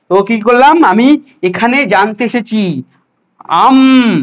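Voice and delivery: normal talking voice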